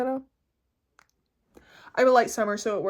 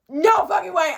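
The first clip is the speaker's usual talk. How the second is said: loud, high burst of disbelief; thrilled, disbelieving outburst; excited disbelief; 7 semitones higher, 9 dB louder than usual. Retold or imitated. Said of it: "I was like".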